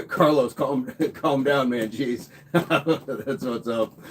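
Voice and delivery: laughing through the words; real laughter. Real, not acted. Streamer telling Carlos to calm down, laughing. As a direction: chuckle as you tell him to settle. Laughing while teasing a viewer.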